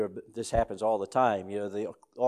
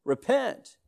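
repent cried out much higher; ringing call; 11 semitones higher than usual. Retold or imitated. Preacher calling the church to repent, high ringing cry. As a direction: cry it out like a summons.

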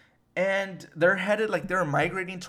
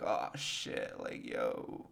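very low, soft, nearly voiceless; hushed mock dread; 11 dB quieter, much slower than usual. Friends realising what twenty twenty brings, hushed mock dread. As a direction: drop to a sinking murmur of dismay. Acting dawning dread of 2020.

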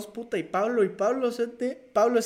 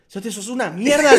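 loud acted telling-off; mock-furious outburst, sharply louder; angry retold scolding; 3 semitones higher, 9 dB louder than usual. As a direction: berate the friend who locked you out. Retold scolding at locked window.

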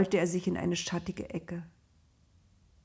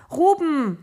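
higher, louder desperate scream; screamed name; 8 semitones higher, 9 dB louder than usual. A narrator's reading. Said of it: "schrie Josef".

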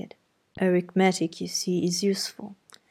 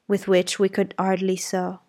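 ordinary talk

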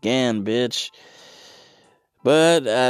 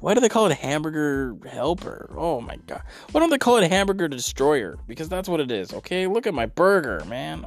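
higher outraged rant; 7 semitones higher than usual. Pretend. Outraged rant about the name.

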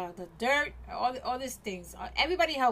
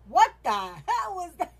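mock shocked plumber; 7 semitones higher, 7 dB louder than usual. Plumber reacts to clogged toilet.

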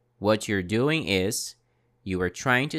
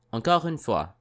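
usual speaking voice

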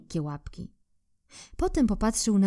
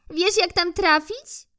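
squirrel asking; 11 semitones higher, 3 dB louder than usual. Acted. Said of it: "zapytała wiewiórka".

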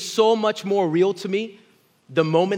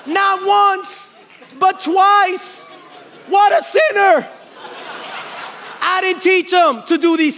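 high, loud fired-up preacher; preaching at full force; 9 semitones higher, 4 dB louder than usual. Acted. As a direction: preach it hard to the congregation.

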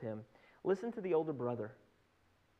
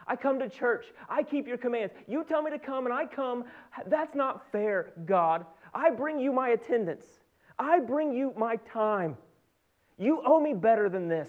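higher, emphatic acted grievance; indignant protest; 5 semitones higher, 3 dB louder than usual. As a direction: press the case against God. Acted churchgoer protesting to God.

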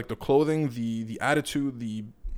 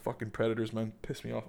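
disgusted mutter; 3 semitones lower, 13 dB quieter than usual. Real, not acted.

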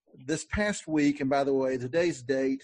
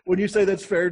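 higher, louder, through laughter; laughing; 7 semitones higher, 5 dB louder than usual. Real, not acted. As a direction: ask it while still laughing. Joking remark about Baptists, laughing.

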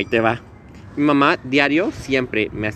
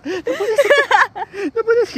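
high, crying mock panic; scared squeal; 3 dB louder, much slower than usual. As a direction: wail it high like a frightened friend. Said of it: "Carito bien asustada". (imitando a Carito asustada, aguda y llorosa)

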